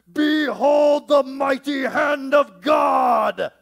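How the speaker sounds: slow, booming hammed-up Moses; Charlton Heston. Mocking Charlton Heston in the Ten Commandments, hammed-up booming shout. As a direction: boom it out like an epic movie prophet. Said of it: "He doesn't do the Charlton Heston".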